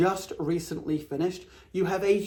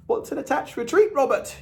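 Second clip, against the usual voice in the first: high, curious questioning voice as a buyer; 9 semitones higher, 4 dB louder than usual. Pretend. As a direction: ask it like a puzzled house hunter, pitched up. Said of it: "you might ask".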